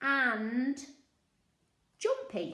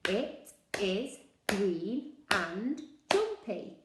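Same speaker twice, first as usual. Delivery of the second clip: slow, word by word; slow, even choral repetition; slow choral read; much slower than usual. Shown as a demonstration. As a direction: say it slowly together. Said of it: "Can you say it with me".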